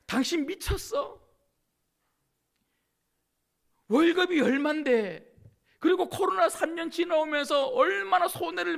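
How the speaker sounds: outraged skeptic mocking a churchgoer; incredulous critic. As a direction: scoff at tithing as madness. Voicing an incredulous tithe critic.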